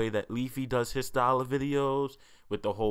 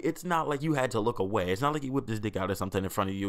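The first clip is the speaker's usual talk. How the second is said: ordinary talk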